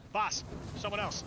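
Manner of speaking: high, loud alert shout; calling out alarm. Acted. Bandit spotting someone, calling out to boss in alarm. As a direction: call it out sharply to warn the boss.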